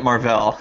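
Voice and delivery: "Marvel" stressed on the second syllable; "Marvel" as mar-VELL. Shown as a demonstration. Announcing a silly new name to co-host, "Marvel" as mar-VELL. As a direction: say "Marvel" to rhyme with gazelle. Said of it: "I'm gonna start calling it".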